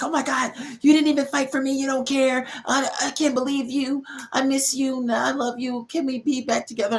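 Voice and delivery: squeaky imitation of a girl begging to get back together; mock panicked girlfriend. Girlfriend begging her calm ex to come back, high and mocking.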